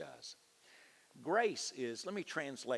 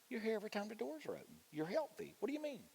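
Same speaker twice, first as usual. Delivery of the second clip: incredulous; 13 semitones higher, faster than usual. Retold or imitated. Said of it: "And I'm thinking".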